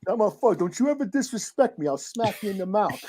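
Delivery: lightly voiced New York Italian tough guy; mock threat in a New York Italian accent; joke New York Italian. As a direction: put on a touch of New York Italian swagger. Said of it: "stereotyping New York Italians".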